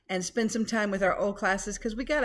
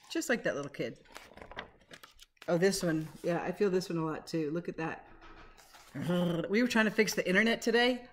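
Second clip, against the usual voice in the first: ordinary delivery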